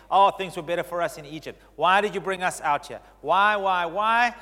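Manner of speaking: whiny complaining. Retold or imitated. Preacher voicing Israel grumbling at God, a whining mock complaint. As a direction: moan like an ungrateful crowd.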